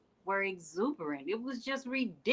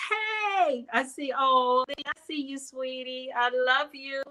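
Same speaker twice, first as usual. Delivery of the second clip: excited greeting; 6 semitones higher, 4 dB louder than usual. Real, not acted. Greeting loved ones in audience.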